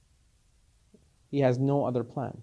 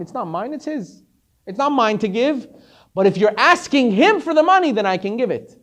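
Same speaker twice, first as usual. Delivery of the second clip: louder, higher, fervent sermon voice; preaching; 7 semitones higher, 7 dB louder than usual. Acted. Rich man preaching that the money is His, fervent. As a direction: preach it with full conviction. Preacher drives home the lesson.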